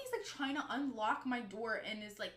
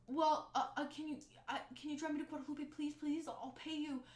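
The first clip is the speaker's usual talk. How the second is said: put-on higher, halting plea from a young stranger; pleading stranger; 5 semitones higher than usual. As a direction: mimic someone nervously begging for a ride.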